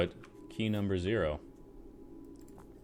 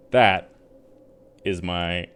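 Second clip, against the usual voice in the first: usual speaking voice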